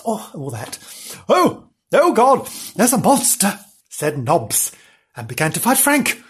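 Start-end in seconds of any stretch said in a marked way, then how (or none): 1.3-3.6 acted fright. Nobbs takes fright in leaves.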